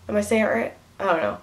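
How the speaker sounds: ordinary talk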